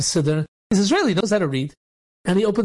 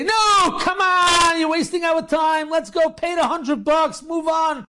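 screaming impatiently; 8 semitones higher, slower than usual. Retold or imitated. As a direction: yell at him to hurry up and pay. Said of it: "start screaming at him".

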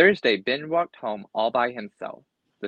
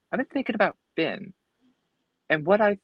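higher and fast, eager; eager friend; 10 semitones higher, faster than usual. A narrator's reading. Fred excitedly tells Ben his plan, higher and fast. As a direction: rush out the big idea.

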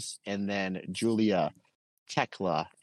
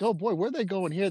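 put-on high, amused oh-boy; mock worried wonder; 10 semitones higher than usual. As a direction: sound half thrilled, half worried. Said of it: "which I'm like".

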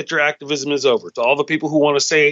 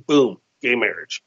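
usual speaking voice

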